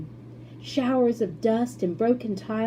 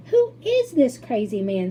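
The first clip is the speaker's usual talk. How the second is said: screamed; 4 semitones higher, 6 dB louder than usual. A narrator's reading. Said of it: "screamed Grandma Josephine".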